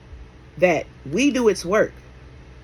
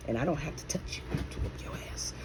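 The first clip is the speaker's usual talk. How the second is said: whispered, much softer threat; menacing whisper; 3 semitones lower, 13 dB quieter than usual. Real, not acted. Menacing aside after threat.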